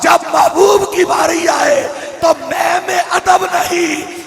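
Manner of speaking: loud, ringing sermon delivery; preaching at full force. Acted. Preacher rousing the congregation, loud impassioned preaching. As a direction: proclaim it to the whole mosque.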